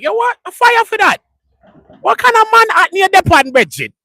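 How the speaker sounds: high, loud mock Jamaican challenge; Jamaican accent. Imitating Jamaicans questioning the word, in a high mock Jamaican accent. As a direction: tease like a Jamaican who can't believe it, in his accent. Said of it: "That's how they say A Os and pauses".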